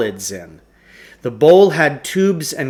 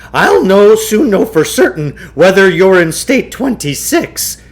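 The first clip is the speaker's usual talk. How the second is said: higher, louder eager showman; excited huckster; 7 semitones higher, 7 dB louder than usual. A narrator's reading. Shopkeeper promising the boys a test, eager high voice. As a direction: sell it like a carnival barker.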